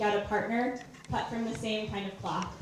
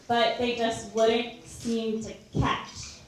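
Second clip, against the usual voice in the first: ordinary talk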